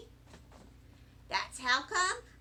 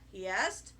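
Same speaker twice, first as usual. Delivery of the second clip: ordinary delivery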